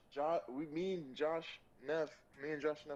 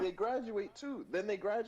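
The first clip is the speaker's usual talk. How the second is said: emphatic; 9 semitones higher, 6 dB louder than usual. Real, not acted. Insisting they still graduate.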